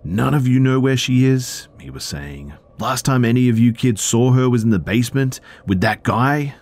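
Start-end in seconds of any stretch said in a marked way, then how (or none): 0.0-1.7 higher, louder angry dad; furious. A narrator's reading. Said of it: "was he absolutely furious". 2.8-6.5 raised, high-pitched angry shouting of a father; furious. A narrator's reading. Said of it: "was he absolutely furious".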